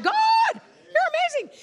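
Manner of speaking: loud, high squeal of praise; laughing, overjoyed cry to God; awestruck outburst. Retold or imitated. Preacher relives her moment of glory in prayer, awestruck outburst. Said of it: "I was like".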